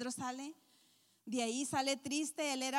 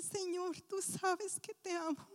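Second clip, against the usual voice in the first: broken-hearted reply; 7 semitones higher, slower than usual. Acted. Peter broken, answering Jesus.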